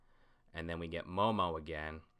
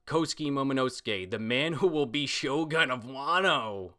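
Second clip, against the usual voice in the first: proud declaration; 6 semitones higher, 4 dB louder than usual. Retold or imitated. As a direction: proclaim it like a boy vowing to be ruler. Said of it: "this is Momo responding".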